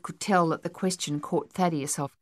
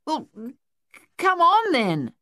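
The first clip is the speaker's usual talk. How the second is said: stammer, then a high yell; loud, high-pitched urging; 9 semitones higher, 5 dB louder than usual. A narrator's reading. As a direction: trip on the first word, then shout it out. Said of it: "Luther yelled".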